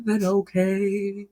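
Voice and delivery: slow, lightly robotic sung ending. Retold or imitated. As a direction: drag out the words like a distorted singer. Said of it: "it makes her voice".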